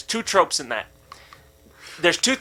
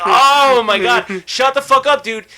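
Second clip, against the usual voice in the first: high, loud exasperation with a drawn-out start; retold inner outburst, fed up; mad; 7 semitones higher, 6 dB louder than usual. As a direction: vent the silent fury out loud. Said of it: "I was so mad".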